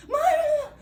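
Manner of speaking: loud, high call of "Mãe!"; son calling loudly. Retold or imitated. Mother imitating her son calling her, loud high yell. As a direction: yell "Mãe!" like her boy across the house. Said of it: "O meu menino fala na altura".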